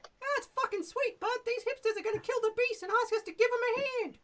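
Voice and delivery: brash goblin challenging strangers at the bridge. Shown as a demonstration. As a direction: squeak it out like a rude little goblin guard. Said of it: "options for if the P C's persuaded Joblin".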